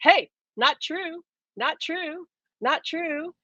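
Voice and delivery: the soul gently calling out a correction; voice of the inner being. Acted. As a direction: call high and gentle, then soften the denials. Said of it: "your inner being is saying".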